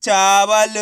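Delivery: चावल loud and stretched syllable by syllable; drawn-out model reading of चावल; blended word. Shown as a demonstration. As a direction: announce चावल with each sound held. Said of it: "तो बताइए मिलके क्या बनेगा".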